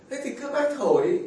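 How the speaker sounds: a hint of the father's teasing; lightly teasing father. The father teasing his wife, lightly voiced. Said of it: "Ông bố".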